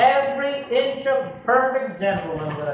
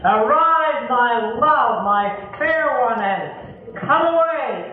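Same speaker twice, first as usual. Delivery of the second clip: tender call; 3 semitones higher, 3 dB louder, slower than usual. Acted. The Lord calling his bride, tender and rising. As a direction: call out tenderly, then let it settle. Preacher repeats the call, slower end.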